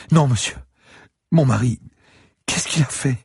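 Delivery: put-on very high, anguished old lady; distraught old woman. A narrator's reading. Old lady asking what her husband did, distraught. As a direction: wail the words as a frantic old wife.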